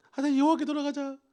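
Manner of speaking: a hammed-up plea to come back to God; calling out. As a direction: people crying out to turn back to God, loudly. Israelites crying out to return.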